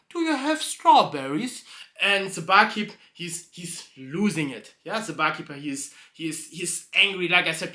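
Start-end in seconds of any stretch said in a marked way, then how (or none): 0.1-1.6 higher, louder put-on horse voice; horse asking again. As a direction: push the third ask up high. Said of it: "the horse asked the third time".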